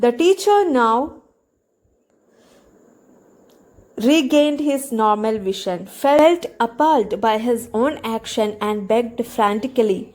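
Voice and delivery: slow, careful reading of the passage; slow reading aloud. Shown as a demonstration. Teacher reading the story slowly to students. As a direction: read it out slowly for the class.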